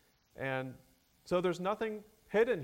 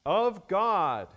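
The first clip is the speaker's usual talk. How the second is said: "God" loud and raised; emphasis on God; 4 semitones higher, 8 dB louder than usual. Shown as a demonstration. Preacher explaining Greek word order to the congregation, "God" stressed. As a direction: lift and punch "God" as the key word. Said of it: "an emphasis on the deity".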